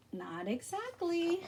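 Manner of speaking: quiet, slow teasing aside; hushed playful correction; soft teasing. A narrator's reading. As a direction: let them in on a secret. Teasing reveal to listeners.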